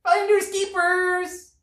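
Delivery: tiny, very high, slow ant voice. A narrator's reading. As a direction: squeak it out slowly like an ant. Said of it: "cried the ant".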